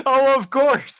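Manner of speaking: high, loud mocking echo; mock agreement. Streamer mimics officer's answer from the clip, loud mock agreement. Echoing the officer's reply while laughing.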